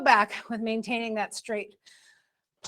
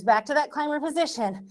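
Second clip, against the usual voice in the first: ordinary talk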